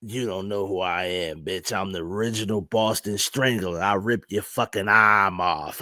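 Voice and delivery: low, soft mock menace; old strangler voice. Mocking the old man from the clip, low soft menace. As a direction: brag like a creepy old killer. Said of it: "He was like".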